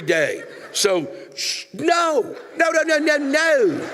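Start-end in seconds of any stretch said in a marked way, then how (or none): 1.7-3.9 pleading denial cracking upward; high exasperated no. Real, not acted. Comic railing at Tara's claim, high strained shouting.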